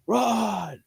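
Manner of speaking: high-pitched held-back yell; stretched, high retold shout; mock yell. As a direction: imitate a drawn-out yell without the volume. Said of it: "you can see Joel yelling".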